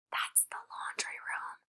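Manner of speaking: slow, soft whisper from behind; a boy whispering behind her back. Acted. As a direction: breathe it out unvoiced.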